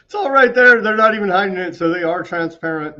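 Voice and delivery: loud, high, fed-up exclamation; real exasperated shout; exasperated outburst. Real, not acted. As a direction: blurt it out in disbelief.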